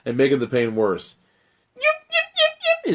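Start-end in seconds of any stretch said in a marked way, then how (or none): 1.7-2.9 very high yips; shrill yipping. Acted. Dragon Fang shrilling in pain. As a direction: yip like a hurt puppy. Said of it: "it shrills again".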